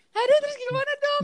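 high-pitched, fretful mock wail; mock panic. Freshman retelling the worry over being barred upstairs, mock panic.

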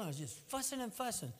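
ordinary delivery

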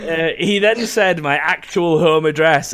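ordinary delivery

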